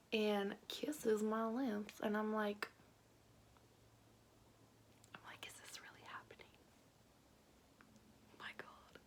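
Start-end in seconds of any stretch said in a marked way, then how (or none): 5.1-9.0 breathless whisper of shock; whispered disbelief. Retold or imitated. Girl thinking during her first kiss, whispering in awe. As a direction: whisper it like you can't believe it.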